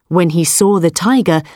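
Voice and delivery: plain reading voice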